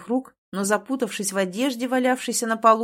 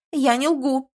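high, louder victim's outcry; exclaimed protest; 5 semitones higher, 5 dB louder, slower than usual. A narrator's reading. Girl playing the victim, exclaiming. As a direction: protest your honesty in an acted high cry. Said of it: "воскликнула девица".

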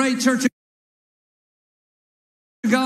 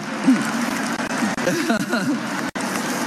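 breathy laugh. Real, not acted. Laughing at his own joke.

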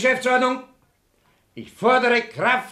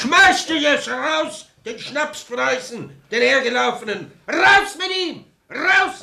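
angry heckling; 4 semitones higher than usual. Acted. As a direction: drive the intruder out with your voice. Heckler demands the Prussian's ejection.

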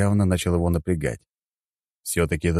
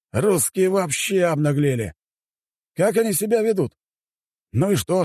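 high-pitched, offended official; indignant chairman; 10 semitones higher than usual. Acted. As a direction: lecture the caller in high-voiced indignation. Chairman grumbles to assistant. (высокий возмущённый голос председателя)

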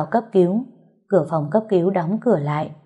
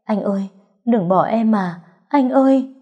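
pleading cry rising to a high, loud call; screaming; 4 semitones higher, 4 dB louder than usual. A narrator's reading. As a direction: beg him not to leave, building to a cry. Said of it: "vừa ôm vừa hét".

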